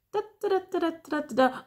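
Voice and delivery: high rapid da-da-da; imitated quick scurry. Reader showing how quickly someone fled, high quick scurry noise. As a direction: sound out a quick scramble in a high voice.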